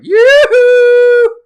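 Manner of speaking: very high, loud falsetto call, long drawn out; cartoon yoo-hoo. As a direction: shriek it like an overeager cartoon lady. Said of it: "I like saying it like that".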